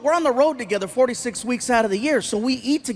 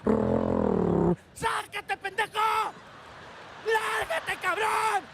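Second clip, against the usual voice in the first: furious Spanish road-rage shouting, far higher; angry Mexican road rage; 10 semitones higher, 9 dB quieter, much slower than usual. Retold or imitated. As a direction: yell Spanish curses at a driver who cut you off. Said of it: "you cut off a Mexican in traffic".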